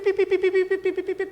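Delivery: high chirpy pip pip with a laugh; playful imitation of angels in the clouds; mock angel chirps. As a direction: tease with silly high pips. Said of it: "ganun yung mga angels".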